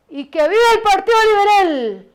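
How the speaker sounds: high, loud political cheer imitating a friend; retold rallying shout. Friend breaking the crowd's silence at a rally, imitated shout. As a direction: cry it out like a rally slogan. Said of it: "Susa gritó".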